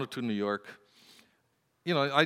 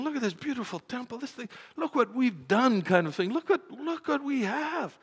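high-pitched, mildly hammed-up wonder; lightly mocking, high-voiced awe; imitated marvelling disciples; 10 semitones higher than usual. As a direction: act out admirers showing off what they see.

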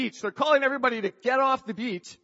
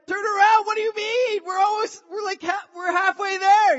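high, loud protest shouted back; acted-out exasperated shout; incredulous yelling; 11 semitones higher, 6 dB louder than usual. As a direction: yell back in disbelief across the water. Swimmers yell back in disbelief.

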